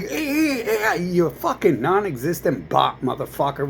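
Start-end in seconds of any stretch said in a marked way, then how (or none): 0.0-0.9 high whiny mock crying; mock whimper. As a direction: whimper like a crybaby, hammed-up. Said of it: "You're like".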